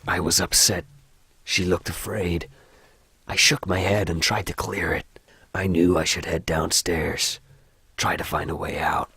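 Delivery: low American story narration; subdued American horror narrator; American accent. Acted. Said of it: "I don't like the American accent".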